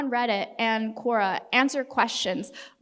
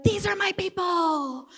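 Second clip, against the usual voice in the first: excited; 8 semitones higher than usual. Retold or imitated. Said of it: "being like".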